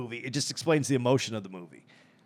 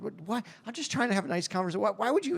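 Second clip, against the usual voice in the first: raised, quick puzzled protest; mock innocent surprise; 9 semitones higher, faster than usual. Killer puzzled why the scared woman pleads. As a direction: act baffled at her fear. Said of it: "And he's".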